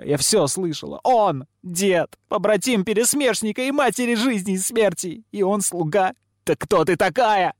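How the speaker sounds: put-on high, heated girl's challenge; outraged confrontation, pitched high; accusing outburst. A narrator's reading. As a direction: build to a high, shrill demand.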